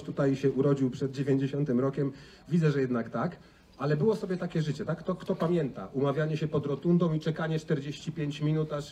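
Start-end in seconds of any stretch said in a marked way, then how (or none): none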